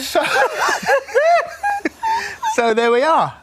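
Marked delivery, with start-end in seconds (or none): 0.3-2.5 high laugh-crying; laughing till tears; hysterical laugh. Real, not acted.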